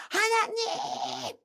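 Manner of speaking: high, slow, drawn-out call with a laugh; joyful calling out. Retold or imitated. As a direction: call out to a newfound father. Child calling God in church. (기쁘게 길게 부르는 외침)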